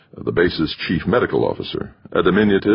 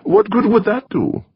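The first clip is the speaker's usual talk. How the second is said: put-on high, pushy foreign-accented retort; countering, accented. A narrator's reading. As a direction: Torok countering Doreen's idea in his accent. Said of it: "Torok countered".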